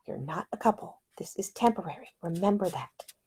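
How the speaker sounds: breathy inner whisper to herself. Acted. Ida warns herself about Nick, whispered. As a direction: whisper a stern reminder to yourself. Ida's inner self-warning.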